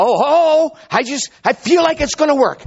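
high, loud, hammed-up emotional voice; mock gushing believer. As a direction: gush it in mock feeling. Mocking someone relying on feelings.

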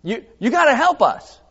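imitating a frantic Gideon; Gideon's plea. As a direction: call out for help, agitated. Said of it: "what he's saying is like".

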